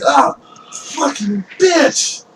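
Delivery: high, slow, pained curse from a slashed thief; wounded thief's curse. Acted. Fleeing thief slashed in the back curses the player, high and pained. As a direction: drag out the curse as the blade hits his back.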